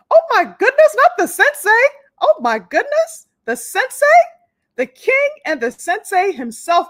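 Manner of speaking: high, loud, delighted gushing; excited greeting. Real, not acted. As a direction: gush with delight at a favourite guest. Thrilled that the sensei joined.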